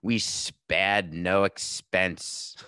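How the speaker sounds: very slow, drawn-out mock Australian accent; badly faked Australian, stretching every word; attempted Australian accent. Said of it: "in an amazing Australian accent".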